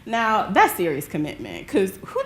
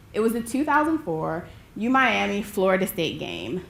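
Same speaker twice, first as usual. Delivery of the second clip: usual speaking voice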